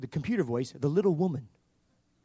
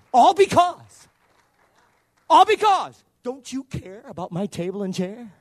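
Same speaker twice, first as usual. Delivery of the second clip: mock complaint; 8 semitones higher, 11 dB louder than usual. Preacher mocks complaint, loud burst.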